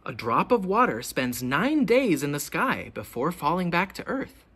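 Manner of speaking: ordinary delivery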